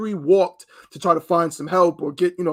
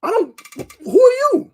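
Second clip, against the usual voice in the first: indignant retort; 4 dB louder than usual. Retold or imitated. Voicing the man confronted.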